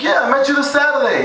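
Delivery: high, insistent reminder; eager stranger. Retold or imitated. The stranger insisting to Jorge they met Saturday, high and eager. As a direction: remind him brightly from up high. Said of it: "he's like".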